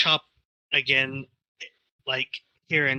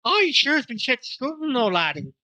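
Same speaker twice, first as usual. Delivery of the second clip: high, playful Irish lilt falling at the end; put-on Irish accent; 8 semitones higher than usual. Showing friends the accent that fooled a friend's wife, mock Irish accent. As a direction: ham up a Scottish-Irish brogue. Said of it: "That sounded like an authentic accent".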